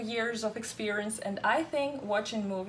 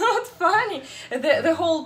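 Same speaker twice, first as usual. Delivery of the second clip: laughing through the words, much higher; 7 semitones higher, 7 dB louder than usual. Real, not acted. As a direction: giggle it out, high and loud. Laughing at Schwarzenegger's accent.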